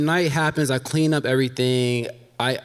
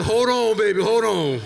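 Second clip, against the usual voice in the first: imitated date; 9 semitones higher than usual. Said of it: "he's like".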